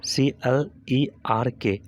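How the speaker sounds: slow, separated letters; letter by letter, unhurried; spelling out. Shown as a demonstration. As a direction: spell it slowly for a class. Teacher spelling out a word.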